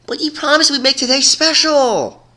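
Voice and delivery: high, loud, eager pony voice with a letdown edge; bouncy high voice, hurt that the promise was broken; energetic, disappointed. Acted. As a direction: plead like a kid denied a treat. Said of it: "replied a more energetic, yet disappointed voice".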